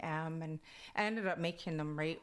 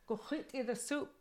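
lightly voiced granny, higher; a higher-pitched, brief command from granny; granny's order; 7 semitones higher than usual. Granny ordering her disobedient grandchild in class, lightly imitated. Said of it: "my granny would go".

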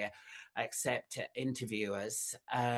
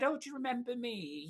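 high-pitched imitation of a friend; lightly acted, high-voiced caller; acted phone greeting; 11 semitones higher, faster than usual. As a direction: lift the voice high, retelling his question. Retold phone call from friend.

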